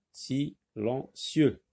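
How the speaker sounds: "silencieux" slowly as si, len, cieux; split into syllables. Shown as a demonstration. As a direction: pause after each syllable of "silencieux". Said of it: "Donc c'est la syllabe".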